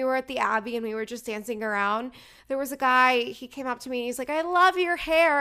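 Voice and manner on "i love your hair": loud, higher, slower gush; gushing compliment. Retold or imitated. Stranger at the Abbey gushing loudly over her hair.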